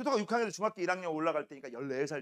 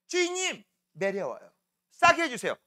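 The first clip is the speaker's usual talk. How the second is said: lightly acted pleading bladder, higher pitched; bladder begging; 4 semitones higher, 3 dB louder than usual. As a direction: plead with the boss to be let go. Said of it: "주인님한테 신호를 보내죠". (살짝 연기한 방광의 애원하는 목소리)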